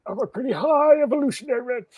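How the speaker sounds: high-pitched, put-on stoner. Co-host punning on a villain's name, put-on stoner voice. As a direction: play someone very high, for a gag. Puns as someone who is high.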